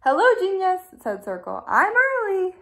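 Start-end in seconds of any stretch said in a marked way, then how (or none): 0.0-0.8 higher, louder bright hello; Circle's chirpy greeting; cheery greeting. A narrator's reading. 1.7-2.6 high, louder bright voice; Circle's voice. A narrator's reading. Circle arrives early.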